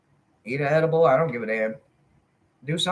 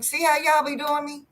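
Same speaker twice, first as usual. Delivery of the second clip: mock complaint; 12 semitones higher than usual. Real, not acted.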